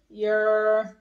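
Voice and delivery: ordinary talk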